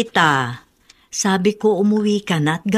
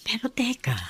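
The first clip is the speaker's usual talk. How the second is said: hushed aside; 5 semitones higher, 9 dB quieter than usual. Acted. Mother pauses, suspicious.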